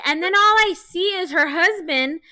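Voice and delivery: ordinary talk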